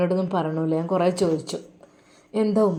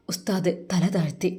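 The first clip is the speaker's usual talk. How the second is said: ordinary delivery, nothing marked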